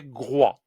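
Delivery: groie with a wa sound, slow; syllable groie. Shown as a demonstration. Teacher giving groie as an example. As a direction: say groie slowly. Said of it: "G R avec un oie, ça fait".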